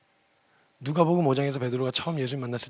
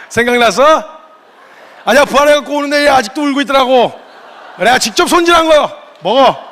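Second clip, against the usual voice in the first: much higher, louder playful teasing; teasing Jesus voice; 9 semitones higher, 12 dB louder than usual. Retold or imitated. Jesus teasing Peter about the rooster chicken, high and loud. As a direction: tease him like an old friend.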